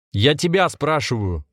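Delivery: slow, high-pitched angry insistence; angry shout. A narrator's reading. Volodya presses his captors, slow angry shout. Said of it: "закричал Володя".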